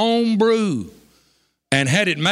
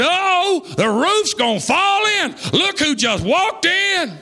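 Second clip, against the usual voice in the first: astonished shout; 11 semitones higher, slower than usual. Retold or imitated. Churchgoers shouting in amazement as the family walks in, astonished cry. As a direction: shout it like the roof's about to fall in. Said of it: "People looked around and said".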